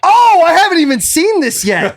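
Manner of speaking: high, loud startled exclamation; surprised. Real, not acted. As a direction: suddenly caught off guard, voice jumps up. Sudden surprise at a tweet.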